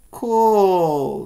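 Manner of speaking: put-on be wowed like a little boy; impressed kid. Jack admiring the toy snake, impressed. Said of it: "says Jack".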